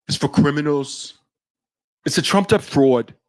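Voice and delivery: put-on lightly done New York tough-guy accent; a hint of a gruff New York Wall Street boss; Jamie Dimon as New York gangster. Mocking Jamie Dimon dismissing Bitcoin, light New York gangster accent. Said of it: "he always sounds like a, a New York gangster".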